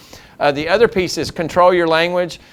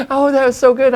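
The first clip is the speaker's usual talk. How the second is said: excited; 11 semitones higher, 4 dB louder than usual. Retold or imitated. Joyful exchange with drive-thru lady.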